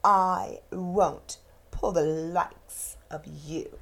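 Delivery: low, slow, scornful engine voice; a snooty big engine sneering; haughty freight engine. Acted. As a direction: look down your nose at them.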